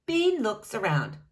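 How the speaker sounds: ordinary delivery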